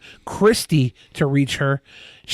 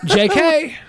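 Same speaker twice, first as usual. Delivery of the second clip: laughing; 12 semitones higher than usual. Real, not acted. Mocking the disclaimer.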